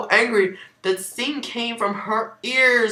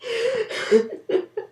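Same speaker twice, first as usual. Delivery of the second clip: shrieking high laugh; high incredulous laugh. Real, not acted. Reacting to the absurd fanfic with a high laugh. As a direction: let out a high disbelieving laugh.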